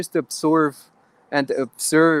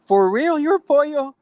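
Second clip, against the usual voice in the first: much higher, louder amazed question; amazed fan; 13 semitones higher, 4 dB louder than usual. Retold or imitated. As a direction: squeal it like a starstruck fan. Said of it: "I'm like".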